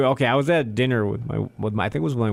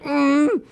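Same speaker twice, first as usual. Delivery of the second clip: high, pinched hum while holding back; holding in water, strained hum; 12 semitones higher than usual. Retold or imitated. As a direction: clamp the mouth shut and hum high. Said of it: "I was like".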